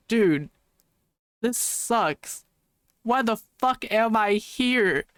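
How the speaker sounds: drawn-out, exasperated inner groan; exasperated complaint. Retold or imitated. Bored attendee griping about a bad panel, exasperated. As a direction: complain slowly, fed up with being stuck there.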